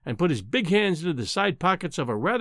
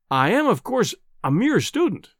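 higher-pitched, ingratiating humility; fatuous smile; 6 semitones higher than usual. A narrator's reading. Bluffing visitor humbles himself to the professor, fatuously smiling. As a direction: say it through a foolish smile. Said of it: "with a fatuous smile".

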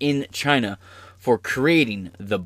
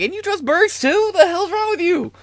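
much higher mock-incredulous voice; incredulous Magic Johnson. Magic Johnson scolding the host for trusting Birx, very high. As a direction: squeak it out in disbelief. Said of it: "he came and he was like".

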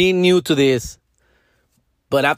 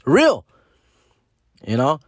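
usual speaking voice

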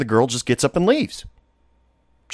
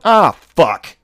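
annoyed curse; 6 semitones higher, 6 dB louder than usual. Retold or imitated. Retold annoyed reaction to badge.

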